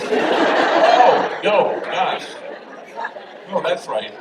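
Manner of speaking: high, loud, laughing through the words. Retold or imitated. Priest laughing at homie's God answer. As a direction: crack up while answering. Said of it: "we just howled".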